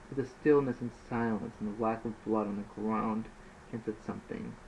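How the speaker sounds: ordinary delivery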